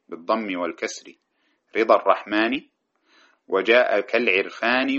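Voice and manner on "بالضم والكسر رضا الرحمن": ordinary talk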